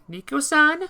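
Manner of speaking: put-on high, loud girlish call; high feminine question; girl's voice. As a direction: call out to him sweetly. Girl character calling.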